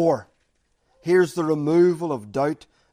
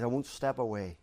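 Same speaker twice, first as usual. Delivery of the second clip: tender voice of God; 6 semitones lower, 9 dB quieter than usual. Acted. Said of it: "And He says".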